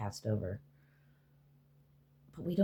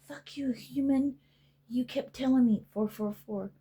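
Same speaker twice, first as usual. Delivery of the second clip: much higher, sneering pet voice; high-pitched bratty insult; mock defiant pet; 11 semitones higher than usual. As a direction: spit it at the owner like a bratty cat. Ego cursing its human.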